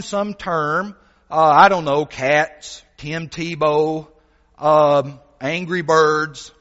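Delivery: sermon delivery. Acted. Preacher telling the congregation a story, lively preaching. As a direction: preach it with energy, painting the scene.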